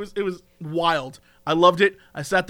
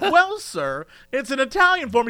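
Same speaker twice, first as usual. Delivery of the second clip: high, polite customer-service voice; imitated barista; 7 semitones higher than usual. Barista explaining espresso to customer, imitated high polite voice. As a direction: explain it delicately in a raised pitch.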